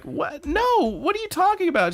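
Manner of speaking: high-pitched flustered denial; hammed-up bewildered squeak; freaked out. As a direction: squeal a panicked protest. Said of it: "so freaked out by this".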